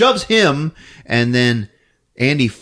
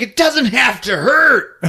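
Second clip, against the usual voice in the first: high, slow, drawn-out plea; hammed-up villain insisting; mock pleading yell; 12 semitones higher, 4 dB louder, slower than usual. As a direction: wail it out slowly, theatrically. Attacker pleading loudly in retelling.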